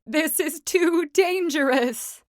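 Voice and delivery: a nervous sheep; worried sheep. A narrator's reading. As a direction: sheep fearing the ride. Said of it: "what she thought was".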